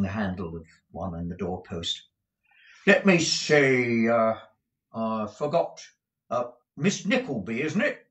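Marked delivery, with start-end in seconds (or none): 2.9-8.1 drawling footman. Acted. Footman toys with Kate.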